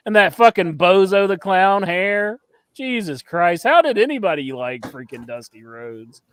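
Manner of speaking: ordinary delivery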